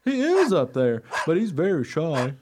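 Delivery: high, loud dog voice, easing down at the end; friendly dog character. Acted. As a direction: explain helpfully as an eager dog. Dog explains the shy man.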